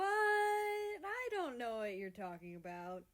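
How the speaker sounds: slow, loud evasive drawl; slowly. A narrator's reading. America to Canada, slow evasive denial. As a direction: stall and play dumb. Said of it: "America says slowly".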